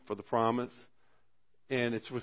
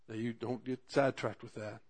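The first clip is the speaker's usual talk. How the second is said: ordinary delivery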